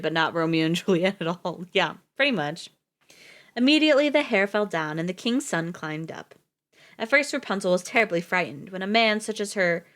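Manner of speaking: usual speaking voice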